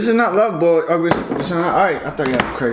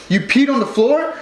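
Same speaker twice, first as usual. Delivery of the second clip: higher, accusing rising question; mom imitation; 8 semitones higher than usual. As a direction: play mom, shocked and higher. Mom discovers the pee.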